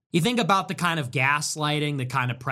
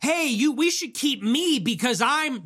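mocking foreigner voice; 9 semitones higher than usual. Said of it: "Foreigner comes here and says".

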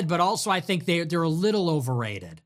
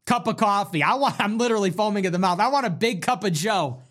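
normal talking voice

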